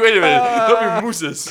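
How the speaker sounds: high, drawn-out mooses, slowly; slow, hammed-up retelling of his own blunder; mock self-quote. Imitating himself at the table, mock drawn-out. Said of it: "I'm just like".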